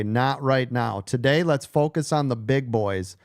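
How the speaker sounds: ordinary talk, nothing marked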